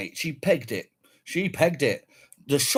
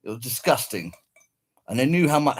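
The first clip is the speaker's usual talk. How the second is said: ordinary delivery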